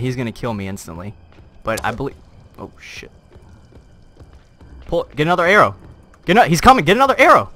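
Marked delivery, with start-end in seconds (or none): 4.9-7.5 high, loud urgent yelling; frantic shouting. Real, not acted. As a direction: shout in a desperate hurry.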